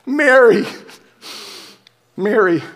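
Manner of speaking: a risen Jesus calls out to Mary; tender call as Jesus. Acted.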